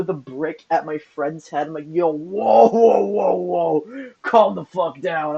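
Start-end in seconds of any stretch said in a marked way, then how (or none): 1.9-5.4 loud retold calming-down protest; acted-out "hold on" outburst; alarmed protest. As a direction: call out a string of whoas to halt someone. Retelling his alarmed protest to strangers.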